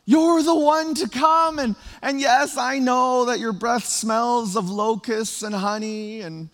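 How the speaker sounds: high, loud, eager pleading to John; excited crowd pleading. Retold or imitated. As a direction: plead with him, half joking, wholly thrilled. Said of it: "they were probably thinking and feeling".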